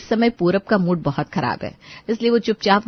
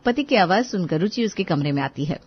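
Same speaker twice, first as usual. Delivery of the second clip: ordinary delivery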